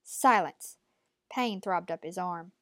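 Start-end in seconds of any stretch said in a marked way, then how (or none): 0.0-0.8 loud, cutting order to be silent; a sudden loud command for silence; sharp command. A narrator's reading. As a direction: bark it out to shut him up. Old woman silences the boy.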